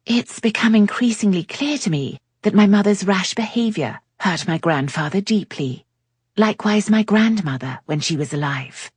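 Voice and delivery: put-on a young lady explaining her family's hurt; lightly voiced Cleo. A narrator's reading.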